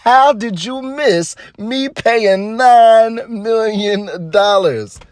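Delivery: high, very slow incredulous question; hammed-up impression of an outraged Tyler; Tyler's outrage. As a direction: drag it out, amazed they missed it. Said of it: "That's what he said".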